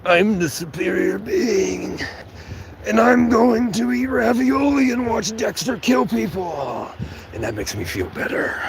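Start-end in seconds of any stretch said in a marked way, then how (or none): 0.0-6.9 high, slow lordly boast; mock grandiose. Boasting to Lily in a haughty high voice. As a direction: puff up like a superior being.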